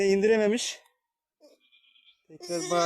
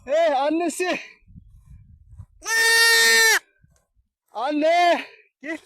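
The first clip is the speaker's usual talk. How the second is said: loud, higher-pitched call into the distance; 7 semitones higher, 9 dB louder, much slower than usual. Real, not acted. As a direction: holler for the mother goat. Anne keçiye uzaktan sesleniyor. (yüksek sesle uzağa seslenme)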